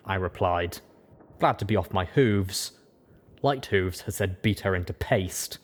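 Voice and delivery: usual speaking voice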